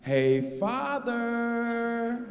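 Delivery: a hint of a prim, cute kid voice; lightly voiced cutesy daughter. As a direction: say Father like a little girl trying to be cute. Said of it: "She tried to be cute".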